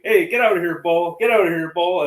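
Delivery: high, loud shout to shoo an animal. Retold or imitated. Hunter on a four-wheeler shouting a bull moose away, loud. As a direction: yell the moose off.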